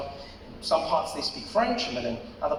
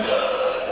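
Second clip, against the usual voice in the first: big mock gasp; horrified gasp; 5 dB louder than usual. Petrified counter woman reacting, horrified gasp. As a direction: suck in air in shock.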